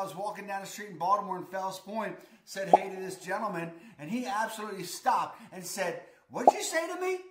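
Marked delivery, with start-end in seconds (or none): 6.3-7.2 high-pitched, louder mock disbelief; imitated affronted "say what?", pitched way up; incredulous challenge. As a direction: act out a taken-aback stranger. Acting a startled stranger's challenge.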